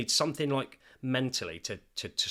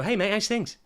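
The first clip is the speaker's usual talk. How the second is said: much higher and louder fake-breezy greeting; mock cheery friend; 10 semitones higher, 7 dB louder than usual. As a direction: play an awkward friend forcing cheer. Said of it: "too scared to go".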